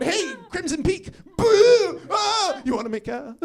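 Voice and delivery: high, with a loud Boo; playful drugged-up nonsense; giddy mock scare; hammed-up party goof. Acting out rolling antics.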